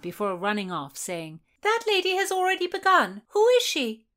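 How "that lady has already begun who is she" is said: high and loud, calling after a runner. A narrator's reading. Running girl calls after a lady, loud and high. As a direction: shout the question across the crowd.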